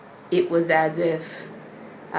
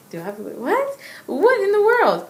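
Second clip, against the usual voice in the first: high, baffled mock outburst; reliving utter surprise, voice leaping up; taken off guard; 4 dB louder than usual. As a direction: blurt it high in startled disbelief. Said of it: "it took me off guard".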